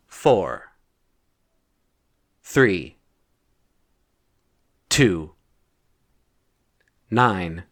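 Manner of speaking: single digits, low and falling, long pauses between; low, measured keypad recital; deliberate code entry. Acted. Typing a safe code digit by digit.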